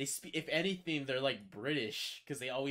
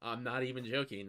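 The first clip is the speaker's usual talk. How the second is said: ordinary delivery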